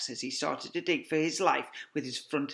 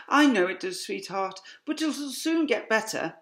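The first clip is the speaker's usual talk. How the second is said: higher, soothing mother fox; comforting mother fox; 9 semitones higher, 7 dB louder than usual. A narrator's reading. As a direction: reassure him gently as the mother fox. Mrs Fox comforting husband.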